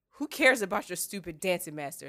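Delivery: put-on loud, indignant young-girl outburst; flaring anger. A narrator's reading. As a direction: snap it loudly, flaring up. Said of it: "Sansa flared".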